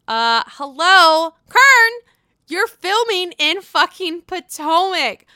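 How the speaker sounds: loud, high disbelieving hello; incredulous loud Uh, hello, Kern; exasperated callout. Real, not acted. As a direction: call her out like she's lost her mind. Mock scolding a cast member.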